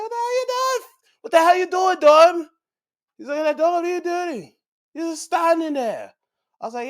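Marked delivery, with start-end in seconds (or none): 0.0-2.5 high, exasperated shout; outraged yelling. Retold or imitated. Viewer yelling at Dom for not helping, high and outraged. 3.6-6.1 high-pitched mock exasperation; exasperated scolding. Wrestler scolding Dom for standing there, high and exasperated.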